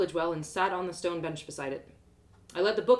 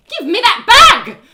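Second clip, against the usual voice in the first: much higher, much louder slow scream; screaming mum; 21 dB louder, slower than usual. Acted. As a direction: shriek it while grabbing. Mum lunges for the bag.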